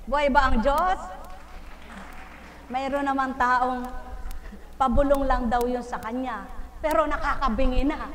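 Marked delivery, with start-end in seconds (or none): none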